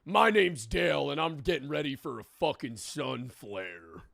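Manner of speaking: lightly voiced gruff Southern prepper; a hint of a rough Southern man introducing himself; brutish Southern man. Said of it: "a brutish Southern male".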